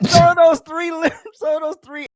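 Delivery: high voice cracking with laughter; laughing through words. Real, not acted. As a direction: try to read but keep cracking up. Laughing while reading comment.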